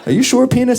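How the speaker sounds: high, loud mock-worried voice; hammed-up nervous squeak. Asking his penis, high.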